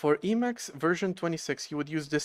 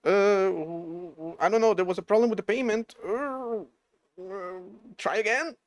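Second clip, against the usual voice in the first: mock customer support; 5 semitones higher, 4 dB louder than usual. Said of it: "they're like".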